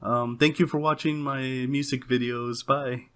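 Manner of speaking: high-pitched mock of unwilling kid vloggers; reluctant kid stars. Teen stars forced to greet their viewers, imitated as reluctant. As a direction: put on a high, half-hearted YouTuber greeting. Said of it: "they didn't want to do it".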